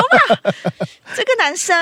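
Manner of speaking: breathless, giggly high gasp over a guy; swooning girl. Retold or imitated. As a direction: gush like a smitten fan. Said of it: "我就说".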